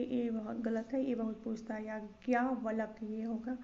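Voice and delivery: ordinary talk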